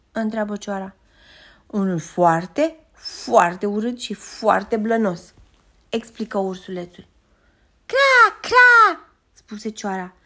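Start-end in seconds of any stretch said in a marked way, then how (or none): 1.7-5.3 foarte stretched slow and heavy each time; drawn-out emphasis. A narrator's reading. Cub telling the crow about the stranger, slow heavy emphasis. 7.9-9.1 much higher and louder, a sharp caw; crow caw. A narrator's reading. Crow in the tree answering the cub, cawing.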